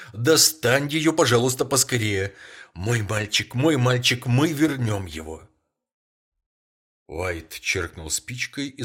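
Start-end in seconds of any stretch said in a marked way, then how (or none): 0.1-5.5 higher and louder, desperate pleading; breathless pleading. A narrator's reading. Wife begs her husband, gasping, to bring the boy back. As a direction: beg him, gasping, desperate. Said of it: "задыхаясь".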